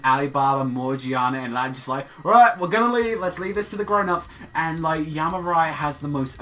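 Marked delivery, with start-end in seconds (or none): 2.2-4.3 acted hasty exit. Voicing friends leaving the couple alone.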